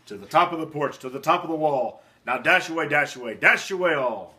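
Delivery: loud, urgent driving calls; shouting to reindeer. Acted. Santa shouts commands to his team. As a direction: spur the team on with big shouts. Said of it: "he whistled and shouted and called them by name".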